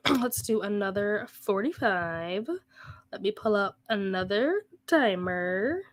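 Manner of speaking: drawn-out, slow sing-song with swooping word endings; odd put-on delivery. Real, not acted. Streamer setting up the next reading sprint, slow playful lilt. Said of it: "I don't know why I'm talking like that".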